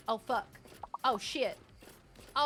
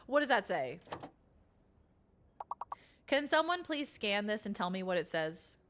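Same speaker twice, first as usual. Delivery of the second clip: ordinary delivery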